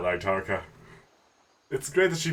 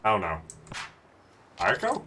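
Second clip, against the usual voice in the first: ordinary talk, nothing marked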